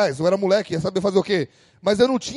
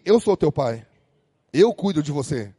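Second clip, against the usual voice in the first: God's voice; 6 semitones lower than usual. Retold or imitated. Voicing God speaking to him.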